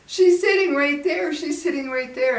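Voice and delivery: put-on high, insistent child's panic; imitated frightened son. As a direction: mimic a scared son pleading to be believed. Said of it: "he was shaking and crying".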